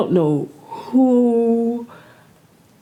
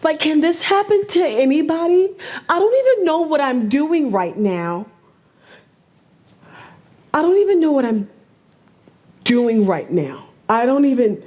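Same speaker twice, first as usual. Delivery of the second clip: upset; 7 semitones higher, 4 dB louder than usual. Real, not acted. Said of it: "Clearly, I'm upset".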